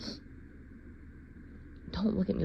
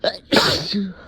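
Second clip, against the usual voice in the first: loud burst; 12 dB louder than usual. Acted. Denial broken by cough.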